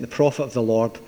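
put-on quiet, low Glaswegian accent. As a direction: say it like a Glaswegian, lightly. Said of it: "best Glaswegian accent".

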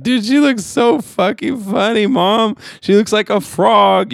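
high, slow, giddy stoned voice; stoned laughing voice. Retold or imitated. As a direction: squeal it out, high and slow, completely baked. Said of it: "I was like".

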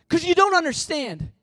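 a touch of hopeless protest, high and loud; mild despairing plea. Acted. Ashamed believer insisting to the pastor, mildly despairing. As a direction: push back against grace.

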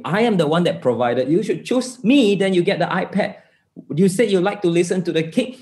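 high-pitched, indignant retold rant; upset rant. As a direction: plead like an affronted father, pitch jumping up. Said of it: "I went ranting and I said".

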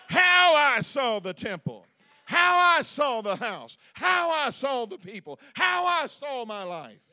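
loud, high, slow build through each line; full-force preaching. Real, not acted. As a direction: hammer every line of the list at the congregation. Preaching climax, building repetition.

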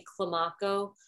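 Climaco said kluh-MAH-koh; own pronunciation. Shown as a demonstration. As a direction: stress the middle of Climaco, with an open "ah". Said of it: "you can say Climaco".

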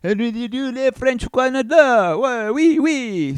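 high, loud hammed-up French accent; mock French accent. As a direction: put on a silly, high-pitched French Canadian voice. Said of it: "with the French accent".

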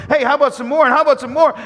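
loud, high, eager offers; getting excited. Acted. Nathaniel to the crowd as his faith grows, excited. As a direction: call it out with rising excitement. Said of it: "now he's getting excited".

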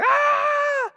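long, very high, loud mock shriek; imitated scream. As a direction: scream like the startled sister, sustained. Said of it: "she's just screaming like".

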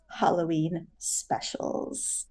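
low, slow haunting voice. Pretend. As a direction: sink it down, slow and ghostly. Teasing Halloween episode theme.

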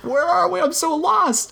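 high, squeaky mock bewilderment; mock lost squeak. Friend echoing the question in a squeaky mock-lost voice. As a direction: whine it like a lost child.

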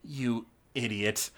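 low, quiet, slow imp voice; displeased imp. Acted. Imp to sprawled Gorbo Borgo, low slow displeasure. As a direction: mutter it slowly at a useless underling. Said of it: "he's like".

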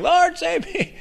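very high, squeezed squeal; mock drowning cry. Someone drowning prays for rescue, very high mock-panicked squeal. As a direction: squeak it out like someone going under. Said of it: "if you're ever drowning".

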